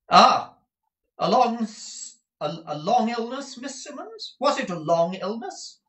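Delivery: very high, insistent questioning voice; pry in a high, piercing pitch; prying busybody. A narrator's reading. Miss Nagg questions Miss Simmons about the illness, high and prying.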